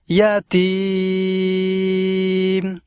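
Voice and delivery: ইয়াতিম with the final তিম stretched long and slow; slow tutorial drawl on ইয়াতিম; long drawn vowel. Shown as a demonstration. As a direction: draw out the তিম of ইয়াতিম like a recitation teacher. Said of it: "এইভাবে".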